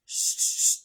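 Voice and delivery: unvoiced shush; quick shushing sounds. Shown as a demonstration. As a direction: hush them with a sharp shh. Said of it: "demonstrating shushing".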